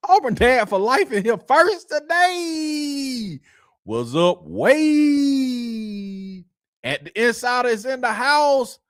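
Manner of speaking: high singsong hype call, names stretched long; drawn-out shoutout. Real, not acted. Host greeting chat regulars as they arrive, drawn-out high shoutouts. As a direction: announce each arrival with long held vowels.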